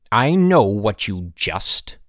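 loud, slow, stern headmaster; cutting interruption. A narrator's reading. Headmaster interrupts a student's excuse, stern. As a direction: cut the boy off with loud, deliberate authority. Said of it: "Mister Sturgeon interrupted".

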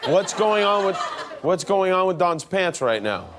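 normal talking voice

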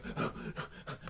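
breathless gasps, acted out; winded panting. Runner retelling his first mile, winded panting. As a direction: pant like a worn-out runner.

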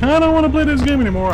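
high, fast whiny quote; whiny mock voice. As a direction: squeal it like a scared kid. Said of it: "and then I'm like".

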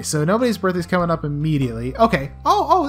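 normal talking voice

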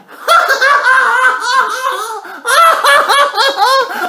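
very high, loud hooting laughter; crowd guffaw. Acted. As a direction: roar with laughter. Audience explodes laughing.

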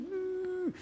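tiny high-pitched mock whine; inner squeal. Preacher acting hidden panic behind a polite greeting, stifled squeal. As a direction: let out a soft, very high strained noise. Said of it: "But inside you're going".

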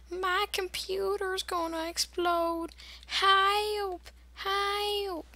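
high playful calls for help; hammed-up panic, calling help; mock cry for help. Panicking at exploding computer.

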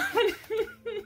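high-pitched real giggling; giggle. Real, not acted. Reader recalling a friend getting knocked out, high giggle. Laughs at the crazy story.

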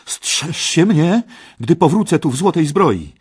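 high, loud defiant warning; scornful threat. Acted. Parsival warns Kej, loud and contemptuous. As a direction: warn your enemy with contempt. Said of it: "spoglądając z pogardą na Keja".

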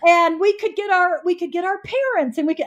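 high, quick and eager; eager kid. Retold or imitated. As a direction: rattle it off like an excited child.

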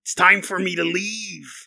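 slow, growled pirate drawl. Pretend. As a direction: ham it up like a pirate. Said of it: "there's a pirate in there".

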